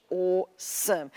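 slow, understated "awesome" in proper Australian English; proper Australian accent, slow and dignified; distinguished colonial English. Shown as a demonstration. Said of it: "It's very distinguished".

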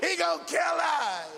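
high, loud panicked wailing; hammed-up terror. Terrified disciples blame Peter for speaking, mock panicked wail. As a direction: wail it in comic panic, stretching the ends. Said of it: "They were terrified".

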